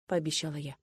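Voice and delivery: ordinary delivery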